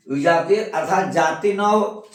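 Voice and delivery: ordinary talk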